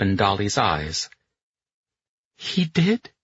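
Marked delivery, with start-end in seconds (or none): 2.4-3.1 tearful hope. A narrator's reading. Dolly tearful hopeful reply.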